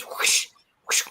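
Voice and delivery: imitated airy whoosh; whoosh noise. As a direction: make the rushing sound of something vanishing. Imitates light rushing away.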